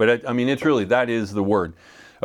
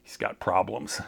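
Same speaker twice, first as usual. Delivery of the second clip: a dry, much softer aside; quiet, deadpan verdict; 6 semitones lower, 9 dB quieter than usual. Retold or imitated. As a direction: say it flat and low like a shrug. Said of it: "He just said".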